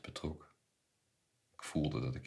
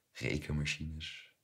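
plain reading voice

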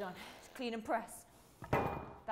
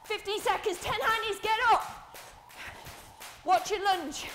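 urgent coaching shout; 6 semitones higher than usual. Real, not acted. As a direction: call out form cues over the exercise. Coach shouting instructions mid-set.

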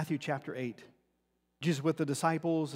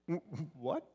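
stammering confusion; 8 dB quieter than usual. Acted. Stunned reply to strange claim.

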